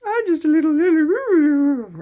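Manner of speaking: very high, loud, drawn-out mock innocence; playing innocent with haters. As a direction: play the helpless little one, teasing. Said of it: "I like to play with them and go".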